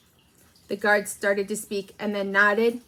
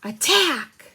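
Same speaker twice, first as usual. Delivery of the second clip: loud, higher battle cry; sudden shouted war cry; shouted attack; 6 semitones higher, 6 dB louder than usual. Retold or imitated. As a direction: bellow the charge order. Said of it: "no, he'd shout".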